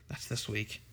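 softly, as it sinks in; quiet realisation. Real, not acted. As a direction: say it low-key, almost to yourself. Realises the convention is this week.

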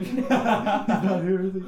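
slow, laughing mock jeer; laughing taunt. Santa gloating to Walter about his missing kid, laughing. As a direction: giggle through the gloating line.

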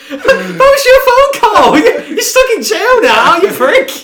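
loud, high, laughing mock Stone Cold taunt. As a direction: yell it high through laughter. Mocking Stone Cold's phone call.

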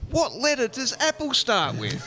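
a high, slow, exasperated question to the phone agent; exasperated mock. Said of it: "I went".

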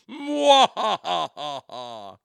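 slow evil villain laugh; mustache twirling villain. Pretend. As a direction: cackle slowly like a cartoon villain.

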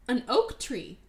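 normal talking voice

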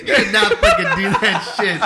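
high, loud, laughing protest; cracking up in disbelief. Real, not acted. Friend reacts to funny story.

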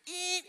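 high, soft single honk; high held beep; imitated car horn. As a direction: mimic one blast of the horn. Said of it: "he starts to honk on the horn".

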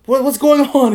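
high, loud mock alarm; alarmed question. Prisoner asking officer what's happening, mock alarm.

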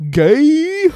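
high, loud one-word blurt. Real, not acted. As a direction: shout the punchline. Shouted punchline.

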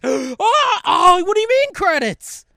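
high, loud, hammed-up disbelief; mock shock. Host mocks a fan shocked by the finale credits, hammed-up high and loud. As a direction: squeal it like the credits caught you off guard.

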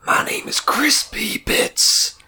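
whispered comic persona; breathy whisper, deadpan. Acted.

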